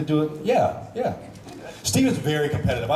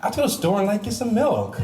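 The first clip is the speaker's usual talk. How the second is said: higher, giggly Felicity with a "like"; Felicity impression; 7 semitones higher than usual. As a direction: pitch up and add a ditzy "like". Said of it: "here it is".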